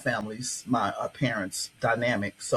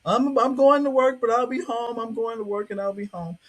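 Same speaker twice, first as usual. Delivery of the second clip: high, strained, tearful voice; a hint of a father crying as he leaves; 9 semitones higher, 3 dB louder than usual. Father tells his family he's going to work, tearfully. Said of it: "He is just crying and crying".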